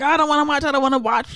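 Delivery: half-hearted mock whine; whiny refusal. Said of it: "Montoya's like".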